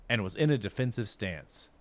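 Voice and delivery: ordinary delivery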